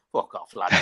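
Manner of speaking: laughing through a brush-off; laughing retort. Retold or imitated. Retelling the prank call, laughing as he swears. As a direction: tell the caller off while laughing.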